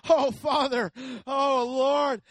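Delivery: hammed-up, very loud plea to heaven; desperate shouted prayer. Preacher acting out praying up the zipline stairs, desperate shouted prayer. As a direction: cry out in mock panic to God. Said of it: "I'm just start praying".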